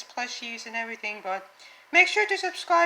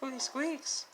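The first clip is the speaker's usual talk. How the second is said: ordinary talk, nothing marked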